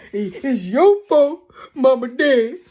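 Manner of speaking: hammered-out mock accusation; Kane blaming Drew. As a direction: Kane yelling at Drew that it's his fault. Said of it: "when Kane got telling him".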